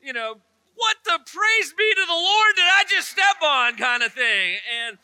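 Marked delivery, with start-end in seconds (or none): 0.7-3.8 much higher, louder yelp after stepping on a Lego; a comic cry of pain, swallowing the cuss word; pained shout. Retold or imitated. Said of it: "I'd be like".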